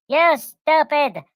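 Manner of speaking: high, slow mock yell at the deer; Cobalt yelling. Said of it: "And then Cobalt's like".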